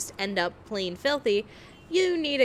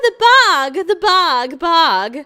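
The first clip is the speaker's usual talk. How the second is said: mocking posh British, drawn-out vowels; hammed-up prim English accent; 8 semitones higher, 13 dB louder, slower than usual. Mocking a chat friend's British way of saying bag, mock British accent. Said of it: "If I'm not British, I'm not going to say".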